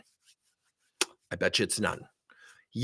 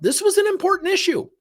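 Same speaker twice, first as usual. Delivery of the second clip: mock importance; 6 dB louder than usual. Mocking the parent's urgency.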